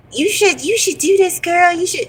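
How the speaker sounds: put-on high, teasing girlish voice; mocking friends' voices. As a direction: mimic the pushy friends, high and sing-song. Friends urging her on.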